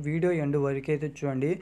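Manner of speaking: ordinary delivery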